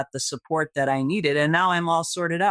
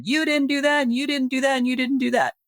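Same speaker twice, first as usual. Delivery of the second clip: much higher, pointed finger-wagging accusations; accusing blame; 10 semitones higher than usual. Pretend. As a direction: hammer each accusation at customer service. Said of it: "come in guns a-blazing".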